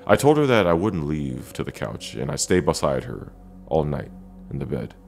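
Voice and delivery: usual speaking voice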